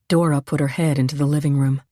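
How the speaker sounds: ordinary delivery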